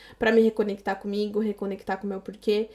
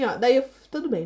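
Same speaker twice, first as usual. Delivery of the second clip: usual speaking voice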